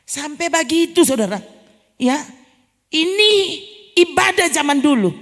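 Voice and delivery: loud, high preacher's voice pressing the point; full-force sermon delivery; fervent preaching. Acted. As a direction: drive it home to the congregation.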